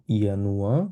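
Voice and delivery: ইয়ানুয়া opening on a Y glide. Shown as a demonstration. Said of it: "কারণ এটাকে আমরা ইয়ট বলি". (ইয়ানুয়া, জ-এর জায়গায় ইয়)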